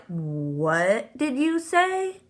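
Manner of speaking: slow, quiet threat; menacing Helena. Acted. Helena turns on the niece with a slow, quiet threat.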